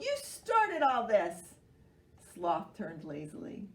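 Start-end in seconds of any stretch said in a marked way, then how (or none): 0.0-1.5 slow, loud accusing; blaming. Acted. Animals blaming Sloth, slow and loud. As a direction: drag it out to blame him. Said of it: "they called".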